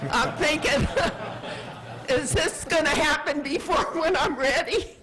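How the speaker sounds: laughing through the words. Real, not acted. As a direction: chuckle while asking. Laughs at her own age joke.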